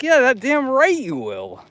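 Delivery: high, slow and emphatic. Retold or imitated. Retelling urging the shy artist, high and slow. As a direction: insist he comes over. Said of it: "I'm like".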